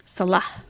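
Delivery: Salah with a short first syllable, suh-LAH; correct stress. Shown as a demonstration. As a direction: model Salah calmly, weight on the second syllable. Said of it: "emphasis is always on the wrong syllable".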